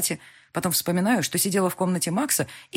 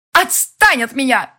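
higher, louder, slow furious scream; desperate scream; 9 semitones higher, 11 dB louder, slower than usual. A narrator's reading. Elvira screaming at the heroine, desperate fury. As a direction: scream to drive her off. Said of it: "так громко и отчаянно".

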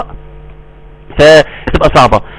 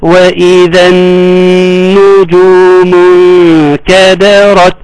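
very slow, sustained tajweed chant; Quran recitation; 3 semitones higher, much slower than usual. Shown as a demonstration. Teacher modelling the verse, very slow recitation. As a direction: hold the nasal noon in a measured recitation.

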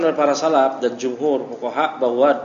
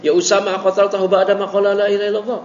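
higher, louder, faster pressing question; cornering tone; 6 semitones higher, 4 dB louder, faster than usual. Shown as a demonstration. Teacher showing the Prophet's intonation, cornering and pressing. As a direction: corner him with every word. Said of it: "Ya, intonasinya".

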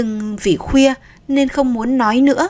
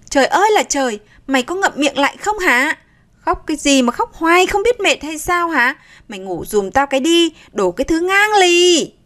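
put-on high, strained yell at the baby; yelling in frustration; 6 semitones higher than usual. Mother yelling at her endlessly crying baby, furious. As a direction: let the frustration boil over into a yell. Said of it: "Cô lại tiếp tục gào lên".

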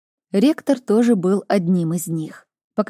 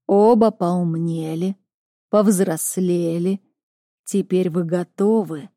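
slow, otherworldly oracle voice; drawn-out, distant seer speaking; prophetic trance voice; slower than usual. Acted. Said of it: "раздался голос Оракула".

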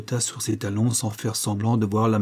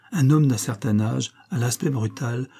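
ordinary delivery

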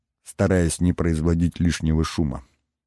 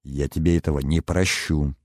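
usual speaking voice

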